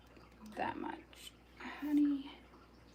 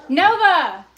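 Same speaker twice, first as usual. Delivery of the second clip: sharp yell at the dog, much louder and higher; 7 semitones higher, 17 dB louder than usual. Real, not acted. Cook to her barking dog, yelling. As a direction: yell the dog's name with a falling tune. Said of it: "I'm not trying to yell".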